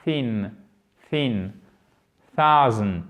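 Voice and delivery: THIN, THOUSAND avec TH soufflé, langue visiblement sortie; TH anglais exagéré. Shown as a demonstration. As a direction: passe la langue entre les dents pour thin et thousand. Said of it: "faites-le chez vous de la même façon". (thin, thousand isolés, TH bien marqué)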